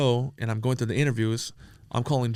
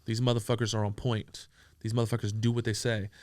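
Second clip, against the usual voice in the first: usual speaking voice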